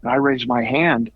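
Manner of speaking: ordinary talk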